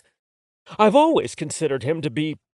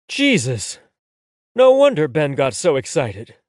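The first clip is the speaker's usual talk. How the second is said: usual speaking voice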